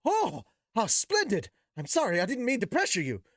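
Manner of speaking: a craftsman lighting up at a gift; delighted Zenoba. Acted. Zenoba thrilled by Rudeus's offer, gushing.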